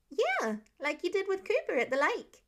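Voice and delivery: high, sure kindergartner voice; a kid stating the obvious; matter-of-fact little boy. Acted. Child reminding Peter matter-of-factly.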